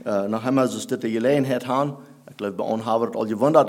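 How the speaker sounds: ordinary delivery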